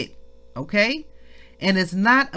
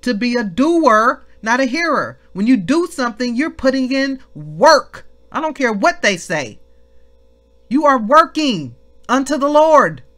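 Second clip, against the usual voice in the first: raised, high-pitched exhortation; full-force preaching; 6 semitones higher, 6 dB louder than usual. Real, not acted. Preacher urging the family to put in work, forceful preaching. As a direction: punch out the key words with force.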